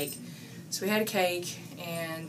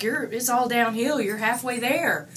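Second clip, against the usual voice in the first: louder, higher mock warning, slowing down; teasing boss; 6 semitones higher, 9 dB louder than usual. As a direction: hammer home the joke that it's all downhill. Boss's teasing punchline retold.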